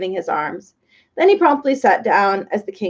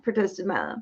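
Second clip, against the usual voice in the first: ordinary delivery, nothing marked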